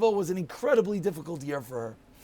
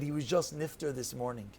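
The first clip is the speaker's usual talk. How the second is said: hushed, lower retold sad news; sorrowful; 6 semitones lower, 10 dB quieter than usual. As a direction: break the news softly and low. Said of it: "with a tear in her eye".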